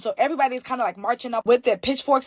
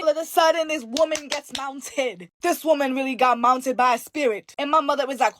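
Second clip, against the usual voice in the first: ordinary talk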